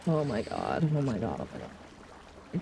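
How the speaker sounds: panicking about the Chicago trip, fading to a whisper. Real, not acted. Fading panicked whisper.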